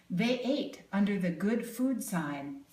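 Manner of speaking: usual speaking voice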